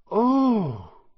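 high, loud gasp of amazement; long wondering "ooh" from a crowd; loud awed "oh". A narrator's reading. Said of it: "There was a loud".

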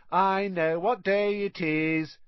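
high, slow taunting chant; jeering sing-song. A narrator's reading. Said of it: "a jeering voice floated across".

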